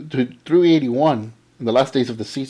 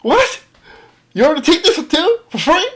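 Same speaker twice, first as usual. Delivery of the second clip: very high, loud what what; can't believe it's free; mock astonished, pitched way up; retold disbelief; 14 semitones higher, 4 dB louder than usual. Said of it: "I said".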